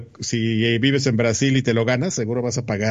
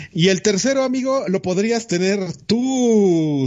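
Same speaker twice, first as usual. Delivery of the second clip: playful announcer; 7 semitones higher than usual. Acted. Teasing host pitch to viewer.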